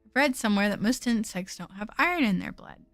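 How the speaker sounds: low, even narration voice; story narration. Acted. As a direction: read the game text calmly. Reads game narration quietly.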